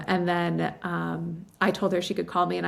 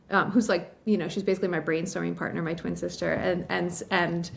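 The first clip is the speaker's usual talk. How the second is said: ordinary talk, nothing marked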